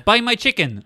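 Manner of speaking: high, loud mock vendor cry; insistent Vietnamese vendor. Retelling chicken seller hassling men on boat, insistent cry.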